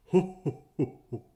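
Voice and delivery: ugly giant laugh. A narrator's reading. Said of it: "laughed an ugly laugh".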